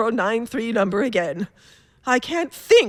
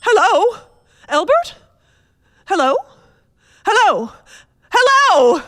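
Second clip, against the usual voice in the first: higher, louder, rising panic on each hello; frantic hellos; 8 semitones higher, 5 dB louder than usual. Acted. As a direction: call into the phone, more desperate every time. Frantic calls on a strange line.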